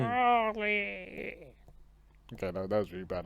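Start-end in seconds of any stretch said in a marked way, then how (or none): 0.0-1.3 high, strained Wall-E robot call; zombie. Shown as a demonstration. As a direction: groan the name like a zombie. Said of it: "I made him sound like a zombie".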